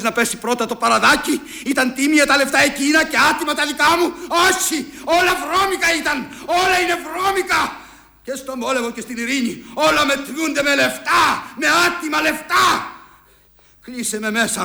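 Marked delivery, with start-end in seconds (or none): 1.7-7.8 high, raised voice climbing with each phrase; indignant outburst. Acted. Father defending his money to his son, indignant outburst. 9.7-12.9 high-pitched, shouted protest; indignant shout. Acted. Father raging that everything is money, indignant shout.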